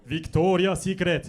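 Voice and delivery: loud, drawn-out mock Japanese accent; slow, booming Japanese anime delivery; Japanese-style voice. Shown as a demonstration. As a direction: stretch it out like a Japanese anime announcement. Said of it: "mais en mode japonais".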